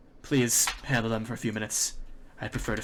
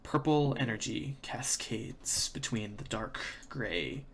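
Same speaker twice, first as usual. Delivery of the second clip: slow, hushed scene setting; game master narration; 3 semitones lower, 7 dB quieter, slower than usual. A narrator's reading. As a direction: paint the scene slowly and softly.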